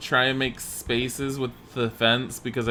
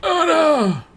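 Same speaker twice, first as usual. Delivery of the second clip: much higher and louder, calling across the room; sudden loud call; 6 dB louder than usual. Real, not acted. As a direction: call to someone far off. Calling out a name.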